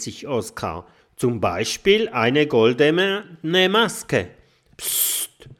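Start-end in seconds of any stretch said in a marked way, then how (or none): 1.2-4.3 eager loud chiming in. A narrator's reading. Oskar chimes in. 4.8-5.5 hushing psst. A narrator's reading. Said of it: "sagt der Professor wieder".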